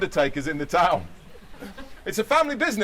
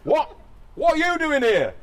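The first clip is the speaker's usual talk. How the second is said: croaked; 10 semitones higher than usual. Acted. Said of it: "croaked Larry".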